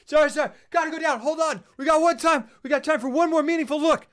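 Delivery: high, loud, hurried yells; mock frantic shouting. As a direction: shout over a storm, hammed up. Acting out frantic sailor's shouts.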